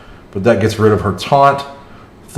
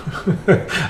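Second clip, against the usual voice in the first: laugh; 7 semitones higher, 7 dB quieter than usual. Real, not acted. Laughs at own Korg voice.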